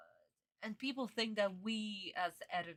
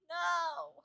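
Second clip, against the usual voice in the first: sharp high No! of dismay; dismayed cry; 9 semitones higher, 3 dB louder than usual. Real, not acted. To viewers, hearing the ending confirmed, dismayed cry. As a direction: cry out No! as the news lands. Learning the spoiler is real.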